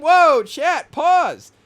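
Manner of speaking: loud, high hype shout; hyped reaction. Real, not acted. Streamer hyping his own bar to chat, loud excited shout.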